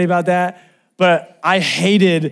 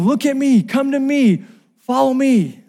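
high, insistent rescuer's call; urgent yelling; 9 semitones higher than usual. Retold or imitated. Lifeguard calling to the drowning brother, high urgent yell. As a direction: call out high to pull their attention to you. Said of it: "The reason he's yelling".

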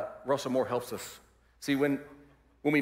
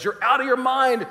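higher and louder mock outburst; 9 semitones higher, 7 dB louder than usual. Festus interrupting Paul, mock outburst. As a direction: scoff at him as mad. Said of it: "Festus interrupts him and says".

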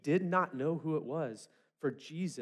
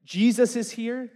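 loud, higher joyful call; shout for joy; 8 semitones higher, 11 dB louder, slower than usual. Pretend. Healed man telling the city, joyful shout. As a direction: announce it to the whole city. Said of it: "shout for joy".

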